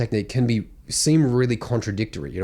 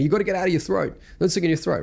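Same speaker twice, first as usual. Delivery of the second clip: slightly raised voice quoting advisers; mimicked advice; 6 semitones higher, faster than usual. Voice coach imitating people giving singing advice, mimicked advice. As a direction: lightly mimic well-meaning advice, pitched up.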